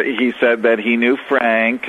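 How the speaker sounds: ordinary talk, nothing marked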